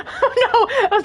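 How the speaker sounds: fast, embarrassed giggling words; laughing. Real, not acted. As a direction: laugh through it. Laughing after a burp.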